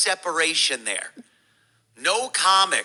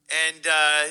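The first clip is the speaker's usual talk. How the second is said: ordinary talk, nothing marked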